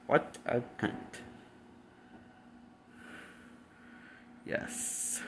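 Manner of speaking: barely voiced, under the breath; whispered aside. Real, not acted. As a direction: whisper the insult to yourself. Muttered insult aside.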